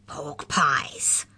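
whispery, menacing hiss. A narrator's reading. Molly joins in against Mallory, hissing. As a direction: hiss it out accusingly. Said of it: "hissed Molly".

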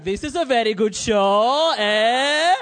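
loud, slow Greek-dad impression; imitated Greek dad. Greek dad hinting to his son over Golden Girls, loud leading tone. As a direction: draw it out with a big questioning "eh". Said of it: "He was like".

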